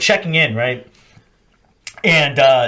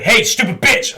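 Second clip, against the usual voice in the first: raised, high call-out; imitated sergeant call; 8 semitones higher, 3 dB louder than usual. Sergeant calling back a dismissed marine, mock yell. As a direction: call him back with an insult.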